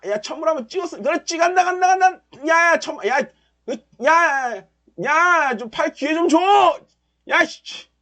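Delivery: high, rapid-fire urging; frantic cheering. Real, not acted. Final surge, cheering.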